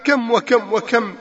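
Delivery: normal talking voice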